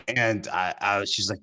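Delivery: normal talking voice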